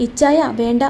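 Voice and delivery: plain reading voice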